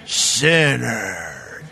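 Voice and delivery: low, scornful ugh, sinner; disgusted crowd. Retold or imitated. Crowd judging Zacchaeus, low scornful sneer. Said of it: "everybody else went".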